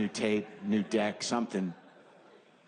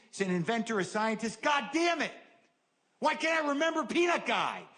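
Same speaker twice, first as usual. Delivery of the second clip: frustrated inner rant; 7 semitones higher than usual. Retold or imitated. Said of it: "your brain just goes".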